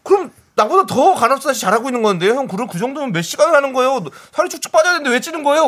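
excited. Retold or imitated. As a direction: gush with disbelief. Retelling own excited reaction.

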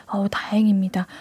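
soft relieved whisper; hushed aside of relief. Real, not acted. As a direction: breathe the relief under the voice.

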